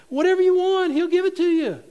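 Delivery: high, loud mimicked proclamation; mock insistence. Preacher mimics teachers quoting Scripture to promise anything, mock insistence. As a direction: crow it like an overeager teacher.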